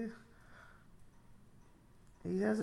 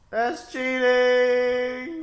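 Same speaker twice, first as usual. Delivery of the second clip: exclamation; 8 semitones higher, 8 dB louder, much slower than usual. Real, not acted. Reacting loudly to movie scene.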